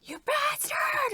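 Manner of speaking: high, slow furious yell; angry shout. Retold or imitated. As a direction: scream it at your enemy. Quoting the character's angry scene.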